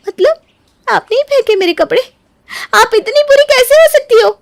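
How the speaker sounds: high, loud cry of disbelief; can't believe her cruelty; outraged disbelief. Acted. Neeru to Gauri, shocked high cry.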